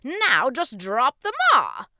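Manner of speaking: slow, booming, high instruction; eager king. Acted. King corrects the boy's attempt, loud and eager. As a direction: coach with huge projection.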